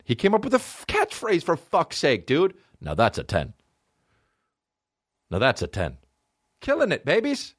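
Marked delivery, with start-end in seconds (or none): 2.8-6.0 taster's verdict, lower. Retold or imitated. Ice cream taster's verdict again.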